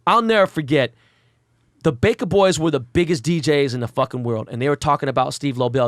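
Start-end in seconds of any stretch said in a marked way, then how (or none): none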